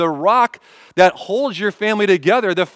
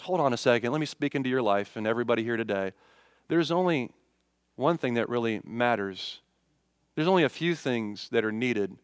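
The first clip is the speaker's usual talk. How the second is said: Jesus answers softly, much quieter and lower; calm reassurance; 6 semitones lower, 8 dB quieter than usual. Retold or imitated. Jesus gently calms fretting Martha, soft and low. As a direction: hush it down, gently settle her.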